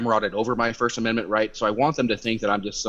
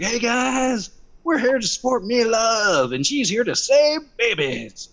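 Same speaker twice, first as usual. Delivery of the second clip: much higher, slow, syrupy rally-host voice; mocking lieutenant governor; 12 semitones higher, 3 dB louder, slower than usual. Mocking the lieutenant governor opening the rally, sugary and slow. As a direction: ham up a cheesy emcee warming the crowd. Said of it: "our lieutenant governor's like".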